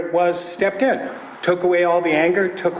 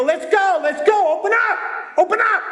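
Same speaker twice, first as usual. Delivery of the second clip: impatient shout; 11 semitones higher, faster than usual. Retold or imitated. Angry dad shouting at the kids to open up. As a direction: pound on the door and yell. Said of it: "I go".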